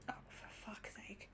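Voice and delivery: exasperated whisper under the breath; whispered curse. Real, not acted. Streamer cursing the passenger to herself, whispered. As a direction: hiss it so the passenger won't hear.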